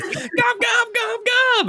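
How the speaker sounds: very high goblin shriek; terrified gobloid. Acted. Gobloid hearing jaws chomp behind it, terrified. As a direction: squeal it in frantic goblin gibberish.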